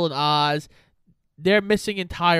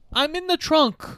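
high-pitched mock call; 11 semitones higher than usual. Captive son calls from the trunk, mock high call. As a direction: pitch it high, like calling from shut away.

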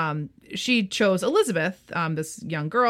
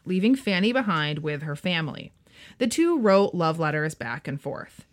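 ordinary talk

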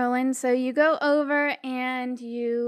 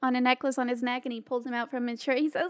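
normal talking voice

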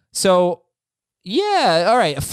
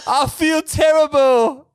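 high, slow mock-British wail; British movie impression; 10 semitones higher, 4 dB louder, slower than usual. Host doing Forgetting Sarah Marshall, drawn-out British wail. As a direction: wail it slowly in a bad British accent. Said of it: "that's my forgetting Sarah Marshall".

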